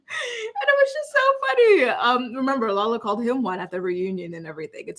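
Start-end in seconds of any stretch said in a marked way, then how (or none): 0.1-1.8 real high-pitched laughter through the words; helpless laughing, voice shooting way up; laughing while talking. Real, not acted. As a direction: crack up and squeal the words out. Cracks up at the retold comeback.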